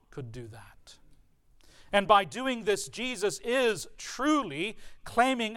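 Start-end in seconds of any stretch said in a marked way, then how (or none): 0.1-1.0 breathy whispered ending; hushed whisper, letting it sink in. Real, not acted. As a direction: drop to a whisper for awe. Climax of the point.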